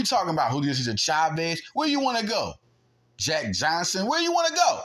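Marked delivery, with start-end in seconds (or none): 1.7-2.5 high-pitched taunt; taunting challenge. Real, not acted. Taunting challenge to imagined doubters. 4.1-4.8 very high-pitched taunt; taunting challenge. Real, not acted. Taunting challenge to imagined doubters.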